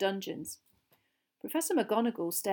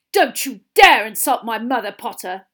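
angry warning; 3 semitones higher, 14 dB louder than usual. Acted. Boy defending his insulted mother to Potter, angry. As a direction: lash out in offence, loudly.

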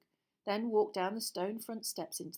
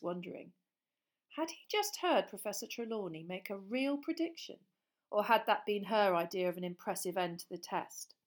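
plain reading voice

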